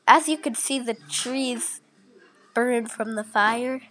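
normal talking voice

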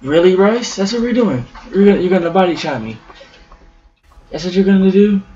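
furious gamer yelling at a rival; outraged disbelief. Real, not acted. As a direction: call him out, loud and incredulous. Outraged at being body shot.

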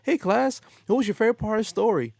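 put-on a grade school teacher asking the kids about the story; third grade teacher. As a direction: chirp it like a cheery teacher quizzing the class. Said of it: "third grade teacher is asking the kids".

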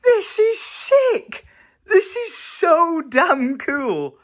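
high, slow, tearful awe; fake-sob over the room; mock crying. Overwhelmed by finished room.

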